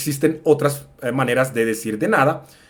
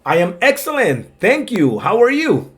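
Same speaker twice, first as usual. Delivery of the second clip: lightly voiced second speaker, pitched up; thinner voice; 8 semitones higher, slower than usual. Shown as a demonstration. Other person answers the greeting, thinner voice. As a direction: answer in a slightly thinner, higher voice. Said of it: "un poquito más delgada en las otras".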